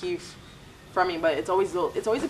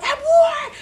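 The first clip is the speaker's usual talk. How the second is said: much higher, louder shout with a laugh; mock battle cry; 8 dB louder than usual. Imitating a Jamaican bystander jumping in to help, laughing shout. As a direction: whoop it up like a bystander ready to fight.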